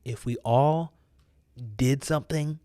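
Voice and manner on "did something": ordinary talk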